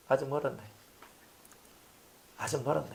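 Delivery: very soft aside to oneself; hushed private judgement; muted verdict. Acted. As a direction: murmur it privately, unimpressed. Voices pastor's private verdict.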